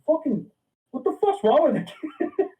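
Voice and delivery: high, mock outraged parent; angry parent. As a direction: squawk it like a furious dad.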